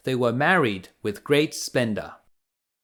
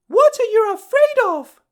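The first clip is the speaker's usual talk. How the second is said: very high and louder crone cry; crying out as the old woman; 7 dB louder than usual. Acted. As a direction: push her, wheedling and loud. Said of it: "cried the old woman".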